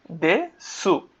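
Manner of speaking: দেসু in two full syllables, দে then সু; spelled-out form. Shown as a demonstration. Teacher reading the written word before correcting it, spelled-out form. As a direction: sound the final u of দেসু. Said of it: "এই দেসুর উচ্চারণ কিন্তু দেশ হয়".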